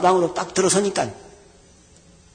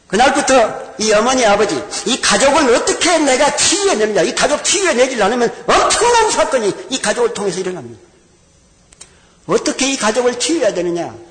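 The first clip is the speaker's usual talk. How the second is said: higher, louder preacher's cry; preaching fervently; 6 semitones higher, 5 dB louder than usual. Acted. Pastor preaching to the congregation about healing the family, fervent. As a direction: preach it with rising urgency.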